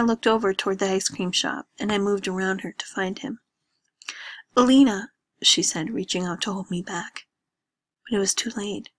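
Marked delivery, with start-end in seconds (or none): 4.6-5.1 louder, higher warning; sharp urgent name; urgent call. Acted. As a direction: call out to hold her back. Joanna tries to stop Lena.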